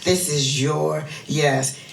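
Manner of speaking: usual speaking voice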